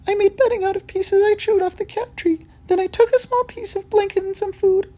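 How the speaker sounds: very high, apologetic squeak; a frightened little mouse pleading; timid mouse. Acted. The mouse apologizes to the cats, very high.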